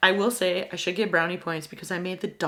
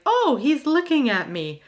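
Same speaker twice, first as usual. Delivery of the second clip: higher, louder delighted exclamation; doctor tickled to see the baby's face; doctor surprised the newborn is facing him; amused surprise; 8 semitones higher, 5 dB louder than usual. Retold or imitated.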